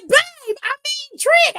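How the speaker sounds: high, loud mock blurting; mock flustered. Imitating Lash slipping up on the pet name, flustered. Acting out her flustered slip.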